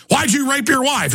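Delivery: lightly voiced sneering accuser; mock accuser. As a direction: throw the accusation back shrilly.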